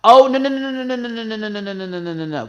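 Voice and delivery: high loud cry sliding down through rapid nos; machine-gun string of nos, falling in pitch; rapid-fire refusal. Real, not acted. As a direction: start high and loud, tumble downward. Emphatic refusal of the brand.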